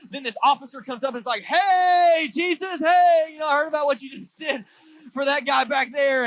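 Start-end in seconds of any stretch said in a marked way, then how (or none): 1.4-6.2 high, loud eager call. Retold or imitated. Officer hails Jesus loudly from the crowd, eager call. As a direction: flag Jesus down across a crowd.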